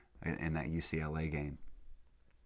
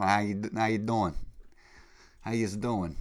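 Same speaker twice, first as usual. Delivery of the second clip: higher-pitched Jersey-accent greeting; 4 semitones higher, 5 dB louder than usual. Shown as a demonstration. Demonstrating how he talks around Jersey people, Jersey accent. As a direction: show off a cheery loud Jersey accent. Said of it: "I talk with a Jersey accent".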